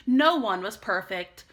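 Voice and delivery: plain reading voice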